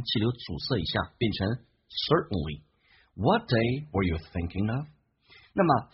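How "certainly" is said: certainly with the T swallowed, airflow stopped before the N; certainly里的T不发音; certainly with held T. Shown as a demonstration. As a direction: glottal stop in place of the T in certainly. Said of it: "T可以不用发音".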